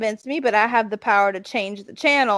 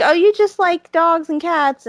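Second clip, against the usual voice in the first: mocking quote; 5 semitones higher, 4 dB louder, slower than usual. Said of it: "that's how you're kind of made to feel".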